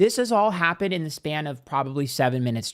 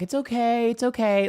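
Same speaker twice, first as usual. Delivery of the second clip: higher, gentle reassurance to a small animal; soothing; 8 semitones higher than usual. Retold or imitated. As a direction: coax a frightened bird calmly. Soothing the trapped bird.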